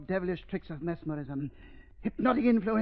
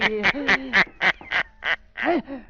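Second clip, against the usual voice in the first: long villain laugh. Acted. Villain triumphs over captive.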